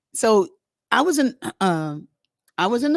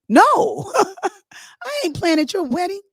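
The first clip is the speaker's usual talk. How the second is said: laughing refusal; 10 semitones higher, 4 dB louder, slower than usual. Retold or imitated.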